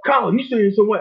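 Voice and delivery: high, incredulous mock question; mock outrage. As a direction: play the shocked accuser. Mock outraged Pan-African reply.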